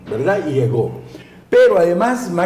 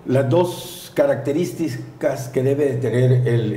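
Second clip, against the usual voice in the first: usual speaking voice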